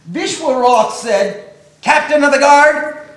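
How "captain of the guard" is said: loud, high royal command; calling out. Acted. The emperor summoning his captain, loud shouted call. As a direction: bellow for the guard across the court.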